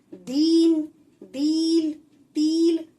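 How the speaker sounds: दीन दील तिल with the ई held long and loud, the wrong way; wrongly stretched vowels. Shown as a demonstration. Teacher shows children the wrong drawn-out reading. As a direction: drag out the short ई as if long. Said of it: "हमें ऐसे लंबा खींच के नहीं पढ़ना है".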